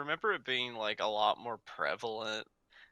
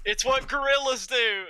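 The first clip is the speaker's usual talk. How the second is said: shouting; 13 semitones higher, 7 dB louder than usual. Acted. Defending gorilla smashing pots.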